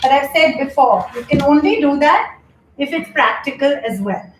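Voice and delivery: ordinary talk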